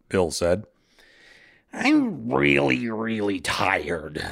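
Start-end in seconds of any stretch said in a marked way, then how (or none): 1.7-4.2 tired whine. Acted. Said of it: "the fate Clotho complained".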